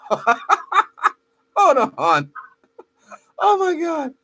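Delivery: high-pitched, laughing disbelief; real helpless laughter. Real, not acted. Host seeing the two pictures together, helpless laughter. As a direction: crack up, voice rising high through the laugh. Cracking up while pulling up pictures.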